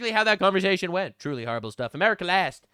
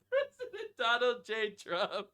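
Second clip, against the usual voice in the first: laughing; 9 dB quieter, slower than usual. Real, not acted. Cracking up reading the signature.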